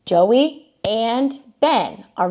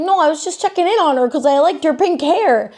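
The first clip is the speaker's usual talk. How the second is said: mock excuse; 7 semitones higher, 3 dB louder than usual. Mocking Joey's excuse.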